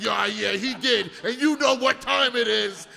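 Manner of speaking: loud, drawn-out mock threat; hammed-up ominous yell. Wife voiced in a story, loud mock menace. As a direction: belt it like a cartoon villain. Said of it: "She's like".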